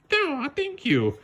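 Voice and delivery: very high, slow put-on voice, flattered; tiny shrew. Shrew thanking for the compliment, very high slow voice.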